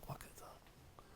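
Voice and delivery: barely audible whisper; inner thought. Retold or imitated. Retold private thought of the rabbi.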